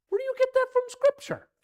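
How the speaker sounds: very high, loud challenge; indignant outburst. Real, not acted. Pastor challenges a preacher's invention, indignant shouting. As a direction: demand an answer in loud disbelief.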